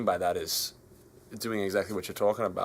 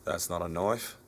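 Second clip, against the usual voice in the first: Australian accent, line delivered a bit low and soft; best Australian accent; 3 semitones lower, 5 dB quieter than usual. Shown as a demonstration. Asked by the American host, Australian accent on show. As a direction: give them the Aussie movie line in your Australian accent. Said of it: "say in your best Australian accent".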